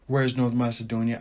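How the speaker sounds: ordinary talk, nothing marked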